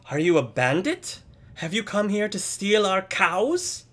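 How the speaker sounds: put-on high-pitched, loud child questioning; little boy's interrogation. Boy questioning a suspected cow bandit, high child voice. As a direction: question the stranger as a suspicious small boy. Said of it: "the boy stops laughing".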